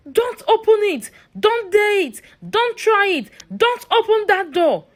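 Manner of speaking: put-on old cleaner bursts in shouting a desperate warning; frantic shout. A narrator's reading. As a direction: stop them at the handle, yelling.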